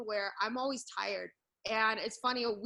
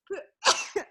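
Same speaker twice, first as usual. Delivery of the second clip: sudden loud cough; one sharp cough; 10 semitones higher, 8 dB louder than usual. Real, not acted. Coughs then apologises.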